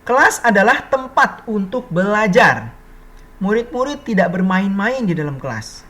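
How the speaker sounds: measured, projected textbook reading; teacher's deliberate read-aloud voice. Real, not acted. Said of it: "[name] bacakan".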